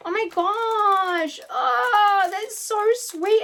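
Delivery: teary delight. Real, not acted. Moved by gift, near tears.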